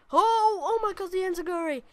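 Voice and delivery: high, loud burst of excitement; real excited outburst at the game; excited shout. Real, not acted. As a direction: yell it like the crowd just popped. Reacting to a big move.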